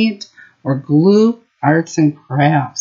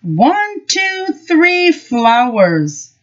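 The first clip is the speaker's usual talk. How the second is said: put-on child counting; 11 semitones higher, much slower than usual. Child Henry counting proudly.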